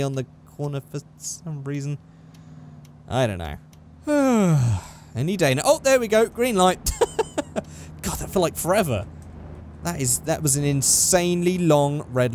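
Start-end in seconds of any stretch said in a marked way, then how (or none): 4.0-4.8 long audible sigh while waiting; real sigh of impatience; impatient sigh. Real, not acted. Waiting at a long red light. 7.0-8.0 short high breathy laugh; real chuckle of relief; amused laugh. Real, not acted. Light finally turns green.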